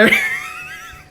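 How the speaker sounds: very high wheezing laugh; squealing laugh. Real, not acted. Streamer cracking up at his own impression, high squealing laugh.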